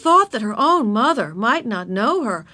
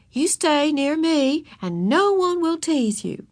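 higher, slow soothing voice; comforting, unhurried teacher; reassuring teacher; 7 semitones higher, slower than usual. A narrator's reading. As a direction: promise a child protection. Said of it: "said Miss Binny".

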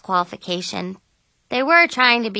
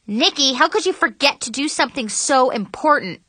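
higher, indignant reproach; accusing, raised-pitch question; exasperated scolding; 5 semitones higher than usual. A narrator's reading. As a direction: scold a friend in disbelief. Violet scolds Nikki for forgetting.

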